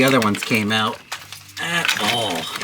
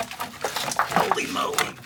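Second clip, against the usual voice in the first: whisper; 7 dB quieter, much slower than usual. Real, not acted. Reacting to crusty bolts.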